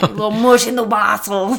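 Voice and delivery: put-on loud, jokey Austrian accent; mock Arnold Schwarzenegger's Austrian accent; goofy Austrian strongman delivery; Arnold impression. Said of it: "that was Arnold's lifting side coming out".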